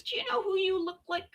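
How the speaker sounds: high, soft nosy-fan voice, a bit fast; people asking. Retold or imitated. Relatives at Christmas quizzing her, high mock-eager voice. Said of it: "everyone comes up to me and says".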